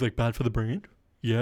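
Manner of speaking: ordinary talk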